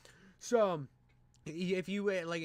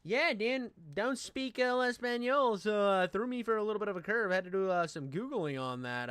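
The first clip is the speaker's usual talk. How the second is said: mock reviewer voice; 5 semitones higher, 5 dB louder than usual. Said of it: "I'll say, uh".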